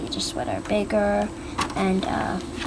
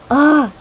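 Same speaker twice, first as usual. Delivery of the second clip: short, loud, higher-pitched "ah!"; startled cry; 6 semitones higher, 13 dB louder than usual. Retold or imitated. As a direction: act out a sudden shock. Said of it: "And she's like".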